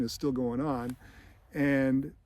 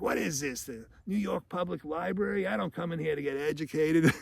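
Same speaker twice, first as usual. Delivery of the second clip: gritty tough guy; 4 semitones higher than usual. Retold or imitated. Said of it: "This gritty guy next to him is like".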